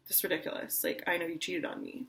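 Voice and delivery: breathy dismissive whisper; scornful whisper. Retold or imitated. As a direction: brush it off under your breath. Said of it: "your person was like".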